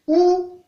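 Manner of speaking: Ou held on a high, level tone. Shown as a demonstration. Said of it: "Ton haut". (« Ou » au ton haut)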